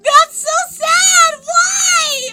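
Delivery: very high, loud mock-distraught cry; hammed-up wailing. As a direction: wail in theatrical despair. Dramatic grief at rat ban.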